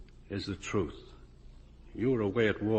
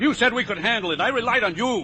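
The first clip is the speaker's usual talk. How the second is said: accusing outburst; 13 semitones higher, 7 dB louder than usual. Acted. Accusing Joe.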